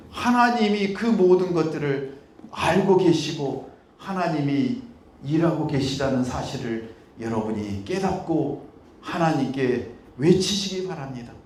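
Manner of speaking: ordinary talk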